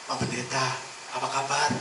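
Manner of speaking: soft, sweet greeting; friendly greeting. Retold or imitated. Church elder greeting the pastor, soft and sweet. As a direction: sound charming and polite. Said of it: "orangnya sangat ramah".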